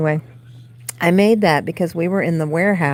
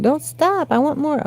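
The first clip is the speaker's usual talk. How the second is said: high, pleading cat voice; voicing the cat; 10 semitones higher than usual. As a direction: speak for the cat, much higher and begging. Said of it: "She's like".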